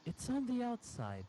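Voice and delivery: high mock girlfriend voice; girlfriend's voice. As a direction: pitch way up to play her insisting. Girlfriend's line voiced in story.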